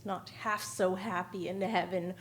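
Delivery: ordinary delivery, nothing marked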